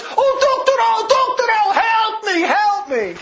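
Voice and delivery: woman on the porch crying for help, mocked; mock wailing. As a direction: wail it like a hammed-up victim. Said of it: "I hear this whole noise".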